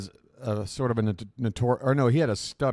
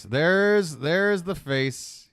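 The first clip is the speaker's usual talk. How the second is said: excited; 6 semitones higher, 3 dB louder, much slower than usual. Real, not acted. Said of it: "You knew one was coming".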